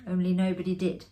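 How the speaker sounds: ordinary delivery, nothing marked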